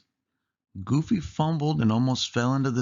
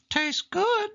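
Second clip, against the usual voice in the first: very high squeaky voice with a laugh; grinning Mickey. A narrator's reading. Mickey tastes Minnie's grape, high laughing squeak. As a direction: chuckle then squeak it happily. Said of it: "said Mickey, grinning".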